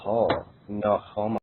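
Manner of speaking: robot voice. Acted. Machine voice repeats its catchphrase.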